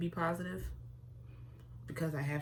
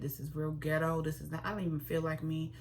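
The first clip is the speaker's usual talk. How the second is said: ordinary talk, nothing marked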